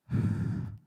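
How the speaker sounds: heavy, voiceless shuddering sigh; demonstrated sobbing breath. Shown as a demonstration. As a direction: let out a big breath, imitating crying. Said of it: "Like that".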